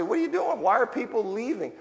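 mock despair at people quitting church; exasperated question. Church members fretting over those leaving, in exasperated voicing.

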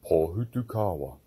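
very deep monster tree voice; dragon voice. Acted. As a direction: boom the name from deep down. Tree villain speaks.

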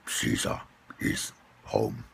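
lightly voiced gruff growl; deep raspy growl. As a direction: growl it low and whispered. Deep gravelly character voice.

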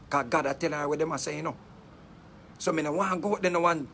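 normal talking voice

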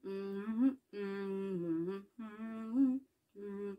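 soft contented hums through the food; humming while eating. Shown as a demonstration. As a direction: hum happily with a mouthful. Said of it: "I always go like this way".

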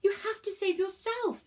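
high-pitched desperate urging; screamed plea. A narrator's reading. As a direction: cry it out high and urgent. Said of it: "Virgo screamed again".

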